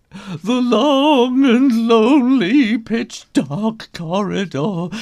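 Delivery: high and loud, swelling on long; dramatic build. A narrator's reading. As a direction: boom it out for suspense. Climax of spooky corridor.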